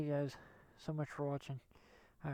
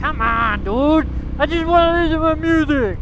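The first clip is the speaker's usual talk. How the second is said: exasperated; 8 semitones higher, 9 dB louder than usual. Real, not acted. Siri not responding, exasperated.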